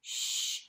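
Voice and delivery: "Shh" hushed, breath only; a long quiet shush; shh sound. Shown as a demonstration. Teacher modelling the sh sound for kids, hushed. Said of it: "finger over your lips and say shh".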